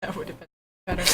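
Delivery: normal talking voice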